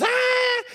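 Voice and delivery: high, far-off squeal of excitement; a yelp that shoots way up; excited squeak. Shown as a demonstration. Preacher showing how his voice cracks when excited, a high squeal. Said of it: "when I get excited, my voice goes".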